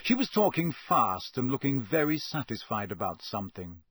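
ordinary delivery

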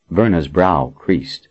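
ordinary delivery, nothing marked

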